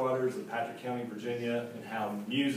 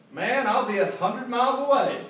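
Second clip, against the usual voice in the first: much higher, louder dismayed protest; exasperated country boy; 11 semitones higher, 7 dB louder than usual. A narrator's reading. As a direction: throw up your hands at the delay. Clayton protests the wait.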